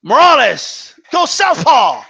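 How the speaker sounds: imitating a ringside commentator's call; mimicking the announcer. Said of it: "And he goes".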